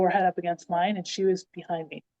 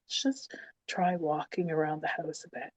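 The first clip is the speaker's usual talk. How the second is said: softer, careful midwife suggestion; gentle coaxing; 3 semitones lower, 9 dB quieter than usual. Retold or imitated. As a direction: coax her quietly, imitating the midwife. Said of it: "I remember being so gentle around me".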